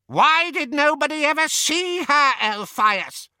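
high, loud squawk; squawking old aunt. A narrator's reading. Aunt demanding answers from Elphias, high loud squawk. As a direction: squawk it like a sharp old witch. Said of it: "squawked Muriel".

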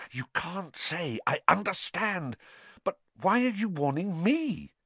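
high, placating urgency; urgent cry. A narrator's reading. Harry grabbing the elf's arm, high urgent appeasing. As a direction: reassure quickly in a high voice. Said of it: "cried Harry".

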